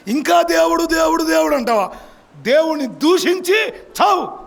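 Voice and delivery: hammed-up nagging wife yelling curse God and die; preacher playing Job's scornful wife, shouting; mock angry wife. Job's wife voiced in sermon.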